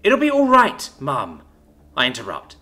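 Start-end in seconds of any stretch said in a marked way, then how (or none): 0.0-1.4 loud, insistent reassurance, dropping on the last word; forced calm. A narrator's reading. Daughter interrupting her distraught mum, forced calm. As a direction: cut in firmly, forcing calm. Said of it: "both lying and forcing calm into my voice".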